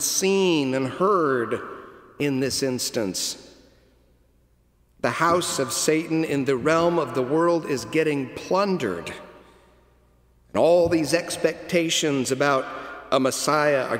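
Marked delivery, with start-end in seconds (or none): none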